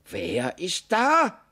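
slow, high drawn-out call; stretched, high questioning shout from the den; calling from inside. A narrator's reading. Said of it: "rief es von drinnen".

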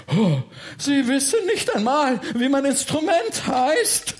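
high, shouted outrage; huff, then shout in affronted disbelief; indignant outburst. Acted. Freiherr is appalled she forgot his instrument, indignant. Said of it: "Der Freiherr war empört".

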